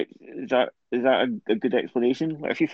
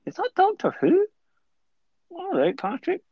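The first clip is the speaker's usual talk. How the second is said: 1 surprised fanboy. Retold or imitated. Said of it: "staring at the screen going".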